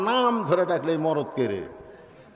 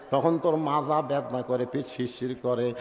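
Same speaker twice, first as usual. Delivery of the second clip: mocking the wife; 6 semitones lower, 4 dB quieter than usual. Husband mimicking wife's excuse.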